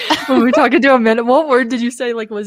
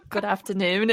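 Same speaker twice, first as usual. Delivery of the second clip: imitated English accent; 9 dB quieter than usual. Retelling the English coworker's greeting, softly mimicked accent. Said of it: "like, the same way he did".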